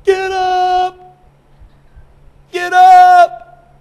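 drawn-out, high-pitched full shout; trainer yelling. Acted. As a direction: holler at a knocked-down boxer. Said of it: "here's what the trainer's saying".

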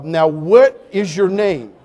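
slow each word, spelling it out; slow, deliberate repeat. Retold or imitated. Preacher slowly repeating the question to the sailor. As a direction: ask again as if to a child.

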